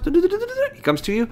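very high, drawn-out puppy-calling voice; little high voice. Shown as a demonstration. Trainer as the dog comes to you, little high voice. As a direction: greet the arriving puppy up high, slowly. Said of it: "get your little high voice".

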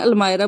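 अलमायरा with "my" in the middle; alternative pronunciation. Shown as a demonstration. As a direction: say अलमायरा as al-MY-ra, not al-mee-ra. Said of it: "कुछ लोग बोलते हैं".

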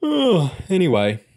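loud, high yawn sliding into speech. A narrator's reading. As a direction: stretch and yawn through the words. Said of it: "stretching into a yawn".

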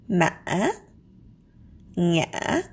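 ma and ngã rise with a short break; broken rising tone. Shown as a demonstration. Teacher gives tilde-tone examples. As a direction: catch then rise on ngã. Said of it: "but there is a short break".

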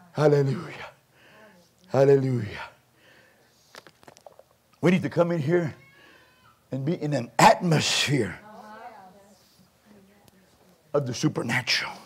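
low, soft preacher aftermath; softly spoken, spaced-out phrases; hushed reverence. Real, not acted. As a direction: drop down low and quiet after the climax. Hushed worship after the climax.

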